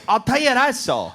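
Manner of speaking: imitated Irish accent. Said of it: "you just go".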